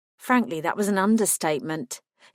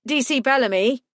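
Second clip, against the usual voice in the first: a hint of a warning call, rising; 5 semitones higher, 4 dB louder than usual. A narrator's reading. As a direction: caution her by name. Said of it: "he said in warning".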